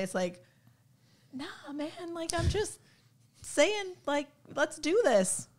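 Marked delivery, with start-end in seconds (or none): 1.3-5.5 higher, bright-voiced playful imitation of herself; imitating herself sounding breezy and casual; high mock self-quote. Voicing herself being direct.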